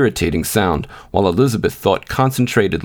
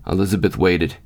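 plain reading voice

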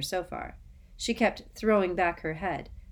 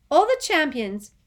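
high, loud squeaky insistence; 10 semitones higher, 10 dB louder than usual. Acted. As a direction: squeak the words urgently. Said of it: "squeaked Colin".

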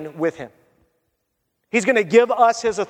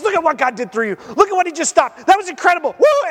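rising higher and louder into a whoop; excited cheering; 8 semitones higher, 4 dB louder, faster than usual. Pretend. Receiver cheers the defender on the field, building to a whoop. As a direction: cheer him on, building to a shout.

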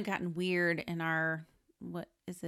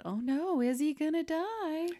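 much higher, fake alarm; mock worried; 12 semitones higher than usual. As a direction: gasp it like a viewer. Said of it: "it gives you that".

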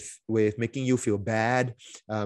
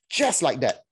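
ordinary talk

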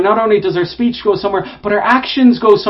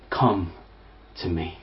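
hushed invitation; 13 semitones lower, 13 dB quieter than usual. Acted. Jesus's words repeated, hushed.

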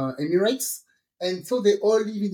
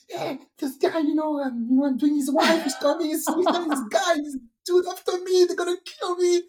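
higher, rushed frightened account; hammed-up frightened babble; mock panic; 9 semitones higher than usual. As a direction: blurt it out scared. Friend panicking retold.

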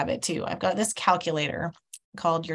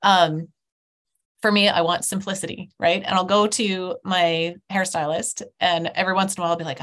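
ordinary talk

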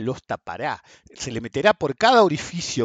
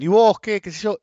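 high, loud sneering retort; mock dismissive taunt; mocking critics; 9 semitones higher, 8 dB louder than usual. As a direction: throw the critics' jab back with scorn. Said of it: "Pero dicen".

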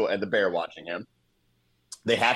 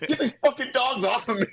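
high, laughing panicked yelp; 13 semitones higher than usual. Retold or imitated. Recapping the movie, high laughing panicked yelp. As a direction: squeal it like a man losing a fight to dogs. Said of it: "I love the dialogue".

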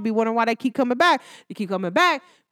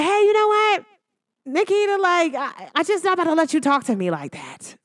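quoting an ex's message; 6 semitones higher than usual. Retold or imitated. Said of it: "The message read like".

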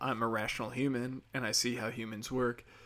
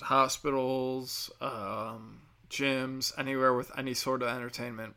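ordinary delivery

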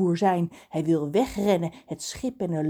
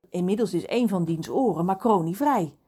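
usual speaking voice

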